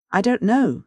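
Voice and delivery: curt furious retort. A narrator's reading. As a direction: snap it back angrily. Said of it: "still furious".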